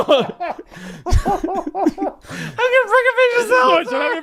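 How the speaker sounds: very high, slow, laughing mock MC call; laughing through it. Comic redoing his MC intro, high and laughing. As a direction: stretch it out, cracking up.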